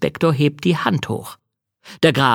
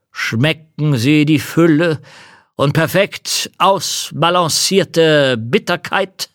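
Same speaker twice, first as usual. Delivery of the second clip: count voice; 5 semitones higher, 3 dB louder, slower than usual. Acted. Count savouring, slow.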